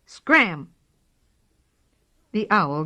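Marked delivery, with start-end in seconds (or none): 0.0-0.7 fierce. A narrator's reading. Kitten threatening the owl.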